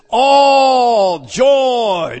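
very slow, loud shout, stressing all; shouted all joy. Acted. Preacher to congregation, all joy shouted slowly. Preacher proclaiming the key phrase.

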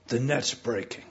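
ordinary talk

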